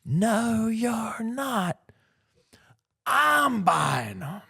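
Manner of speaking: put-on high, slow, shrieking old-woman voice stretched out; horrifying yell. Wife forbidding her husband to sell the coins, high slow shriek. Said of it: "horrifying voice you could ever yell".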